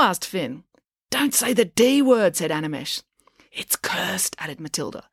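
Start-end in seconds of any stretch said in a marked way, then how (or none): 1.1-2.4 alarmed warning. A narrator's reading. Horrified warning about cursed word. 3.5-4.4 hushed, whispered warning; ominous whisper. A narrator's reading. Matilda warns Finn of the curse, whispering.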